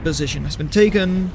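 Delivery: usual speaking voice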